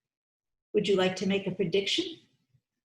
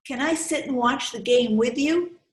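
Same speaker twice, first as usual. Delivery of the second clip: friendly offer; 6 semitones higher, 3 dB louder than usual. Acted. Kendra offers to sit with Victor.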